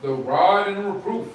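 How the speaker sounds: booming Bible verse read aloud; loud scripture reading. Real, not acted. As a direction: read it out like from a pulpit. Rereading verse on request.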